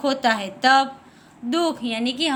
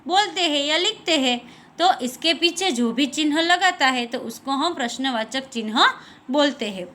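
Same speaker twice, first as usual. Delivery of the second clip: ordinary talk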